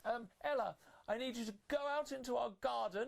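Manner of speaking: high bossy stepsister voice. Acted. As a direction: command her like a pampered mean sister. Stepsister Gloria giving orders.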